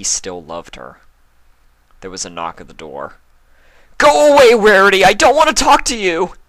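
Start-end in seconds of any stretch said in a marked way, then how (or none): 4.0-6.3 very loud, high furious shout. A narrator's reading. Spike yelling at Rarity, a loud furious shout. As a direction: yell her away through the door. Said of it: "he shouted".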